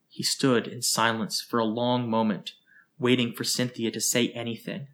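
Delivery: usual speaking voice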